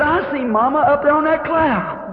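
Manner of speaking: put-on high, light little boy's voice; a small boy seeing his mother in the sky; child's wonder. Little son tells his father he sees Mama, in a high childlike voice.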